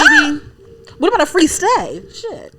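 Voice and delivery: high, loud excited yelp; host squeals at the free tour; excited shriek. Real, not acted. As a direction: squeal with delight.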